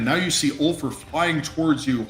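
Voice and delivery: ordinary delivery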